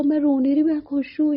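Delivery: laughing through tears of joy; teary joy. Retold or imitated. Answering the aunt through tears, overjoyed.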